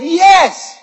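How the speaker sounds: high, loud excited yes; eager shout. Retold or imitated. Preacher reenacting his excited answer to the Lord. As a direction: burst out yes.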